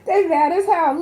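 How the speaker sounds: high, loud, laughing excitement; high-pitched delighted hollering; amused outburst. Real, not acted. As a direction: exclaim it loudly, tickled by their anger. Cracked up by their anger.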